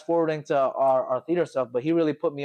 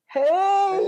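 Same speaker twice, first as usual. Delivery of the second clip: higher, louder squeaky awkward hey; 5 dB louder than usual. Acted. Jimmy awkwardly greets his ex in a higher squeaky voice. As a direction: greet her awkwardly, voice cracking up. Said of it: "the awkward".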